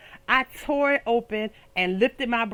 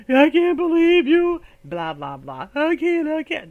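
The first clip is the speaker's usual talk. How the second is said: loud, high mock sobbing complaint; mock crying; 4 semitones higher, 5 dB louder, slower than usual. Girlfriend mock-sobbing at her man in the street. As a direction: ham up crying at him. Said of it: "And now I'm crying".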